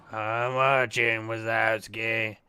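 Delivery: very slow drawn-out slug impression; Monsters Inc slug. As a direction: drag it out slowly like Roz. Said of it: "sound like the slug from fucking".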